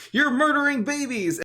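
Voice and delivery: high-pitched imitation of a protester's yell; mock yelling. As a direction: mimic an outraged chant without full volume. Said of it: "and yelling".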